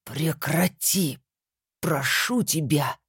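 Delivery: slow pained groaning plea; moaning plea. A narrator's reading. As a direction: beg through clenched teeth, slow. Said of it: "простонал всесильный Люцифер".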